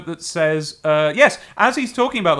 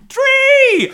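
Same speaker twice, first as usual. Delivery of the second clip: very high, loud shriek of "Tree!"; alarmed warning; 6 dB louder than usual. Shown as a demonstration. Showing how the gag should sound, alarmed shriek. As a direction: shout it as an urgent high warning. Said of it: "as a warning or as a statement of alarm".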